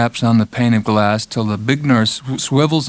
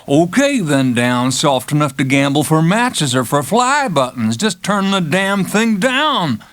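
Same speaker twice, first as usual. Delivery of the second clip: higher, slowing on the last demand; insist, slow and fed up, just turn it down; a cocky patient losing patience; McMurphy, exasperated; 5 semitones higher than usual. Acted. McMurphy pressing again.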